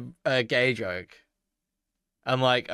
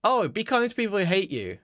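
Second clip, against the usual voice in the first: high, sugary mock preachiness; lightly mocking sweet voice; mocking quote; 8 semitones higher than usual. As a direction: parody a smug rich woman being saintly. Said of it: "to sit there and say".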